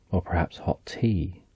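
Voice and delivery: ordinary delivery, nothing marked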